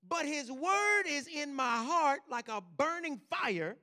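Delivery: loud, slow, burning preached reading; impassioned scripture reading. Acted. Pastor reading Jeremiah to the church, loud and slow. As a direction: let the fire in the bones break out.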